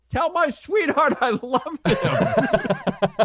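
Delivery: high, slow, swooning falsetto; drawn out high like a fainting damsel; mock damsel pilot. [name] mocking a woman pilot on the mic, high swoon.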